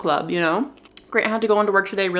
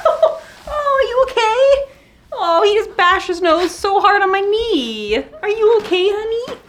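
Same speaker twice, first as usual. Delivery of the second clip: high pet-talk voice; 13 semitones higher, 4 dB louder than usual. Real, not acted. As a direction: coo worriedly at a hurt puppy. Comforting dog that bashed its nose.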